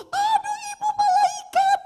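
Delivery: very high, sustained squeal; high mock shriek of amazement; imitated shriek. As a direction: mimic her overjoyed scream, pitch right up. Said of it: "karena dia jerit begini".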